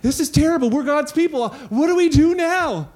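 high, loud panicked cry; frantic alarm after losing the battle; panicked Israelites. Retold or imitated. Israelites panicking after defeat, high loud alarm. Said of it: "they're like".

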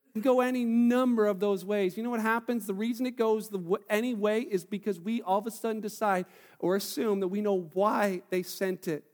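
ordinary talk, nothing marked

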